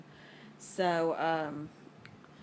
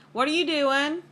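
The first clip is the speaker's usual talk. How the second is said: calling out; 9 semitones higher, 7 dB louder than usual. Real, not acted. Mother calling out to her wandering daughter. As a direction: call across the house to her.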